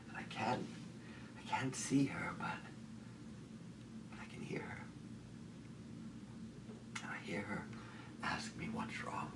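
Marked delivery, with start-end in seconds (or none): 4.1-9.3 soft, whispery and hesitant; hushed dread. Acted. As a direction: whisper as if listening for her. Hearing her in the dark.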